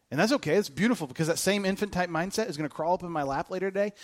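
ordinary delivery

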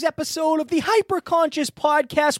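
loud, high show-opening announcer; announcer intro. Acted. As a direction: boom it out like a show host. Podcast host's opening announcement.

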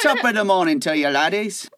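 hammed-up muddled British Isles accent; jolly, botched Irish-British accent; badly faked accent. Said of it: "trying to do a British accent".

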